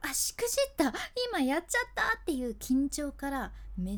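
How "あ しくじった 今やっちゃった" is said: panicked inner voice. Retold or imitated. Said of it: "あとなんかもう".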